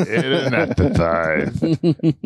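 slow lisping cartoon duck voice; Daffy Duck lisp. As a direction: spit out the word anesthetized with a heavy lisp, slowly. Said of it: "That's like a Daffy Duck".

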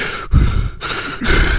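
heavy, voiceless panting for effect; imitated heaving breaths. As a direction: heave breath like the woman staring. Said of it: "just heaving in the rain".

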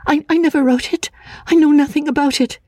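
panicked pleading in a high voice; desperate denial. Acted. Anne denying the letter to Walter, high and frantic. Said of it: "struck her hands together in despair".

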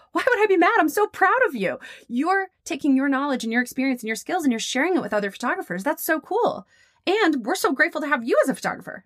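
high, emphatic reassurance; gushing client. Retold or imitated. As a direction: imitate a client bursting with pride. Client insisting she is proud.

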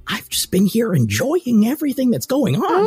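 high, cheery put-on voice; companion's chirpy voice. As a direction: sound delighted and a bit silly. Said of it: "He's like".

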